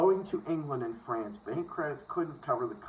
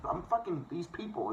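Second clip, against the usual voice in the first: ordinary talk, nothing marked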